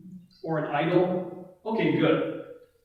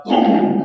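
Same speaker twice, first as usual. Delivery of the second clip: loud, drawn-out boom; booming impact; 11 dB louder than usual. Retold or imitated. Telling the crowd how God answered, loud imitated boom. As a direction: make a big loud boom like a blast. Imitates fire striking from heaven.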